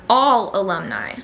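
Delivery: all pressed hard, falling; stress on all. Shown as a demonstration. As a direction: lean on the word all. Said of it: "And I'm emphasizing the word all".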